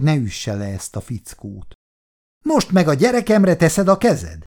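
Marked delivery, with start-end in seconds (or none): 2.4-4.4 yelling. A narrator's reading. Milliken yells at Chris over his child, raised voice. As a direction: bellow the accusation. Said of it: "üvöltötte Milliken".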